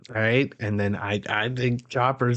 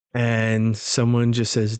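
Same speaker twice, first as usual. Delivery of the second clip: ordinary talk, nothing marked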